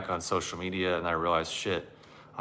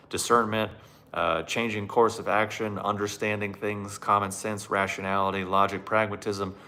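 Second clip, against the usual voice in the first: usual speaking voice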